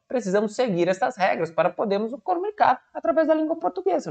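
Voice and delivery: usual speaking voice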